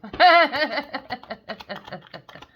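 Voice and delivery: long, loud burst of laughter. Real, not acted. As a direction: laugh out loud, high and rattling. Laughs at own impression.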